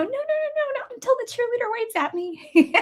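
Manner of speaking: put-on very high stubborn little girl. Voicing the little girls refusing teachers, in a high childish voice. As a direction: pipe up like a kid refusing to sit. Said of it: "they'd be like, little girls".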